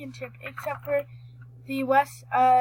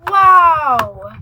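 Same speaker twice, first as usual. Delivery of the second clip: excited; 2 semitones higher, 14 dB louder than usual. Real, not acted. Amazed at riding hall.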